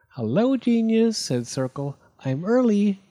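breeze in delighted; cheery Circle. A narrator's reading. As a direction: Circle arriving early, high bright greeting. Circle arrives cheerfully early.

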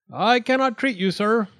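high, loud refusal; shouted. A narrator's reading. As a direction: call it out forcefully to turn someone away. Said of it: "Doctor DeSoto shouted".

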